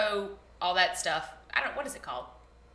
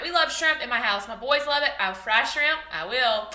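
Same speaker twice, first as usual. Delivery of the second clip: ordinary talk, nothing marked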